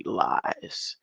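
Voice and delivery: breathed-out accusation; whispered. Real, not acted. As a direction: hiss the word in a whisper.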